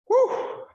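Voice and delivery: high loud woo of relief; excited whoop. Real, not acted. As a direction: let out a happy whoop. Refreshed after drinking water.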